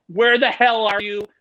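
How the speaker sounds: loud, slow, drawn-out questions; mock incredulous. Friends react to the photo online, loud slow mock disbelief. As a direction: stretch each word in exaggerated disbelief.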